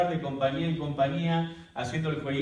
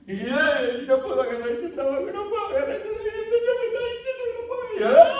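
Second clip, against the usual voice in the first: much higher, louder wailing, nearly sung; desperate crying; 9 dB louder than usual. Retold or imitated. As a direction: cry out in despair to God. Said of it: "estoy ahí desesperado, gritando y llorando".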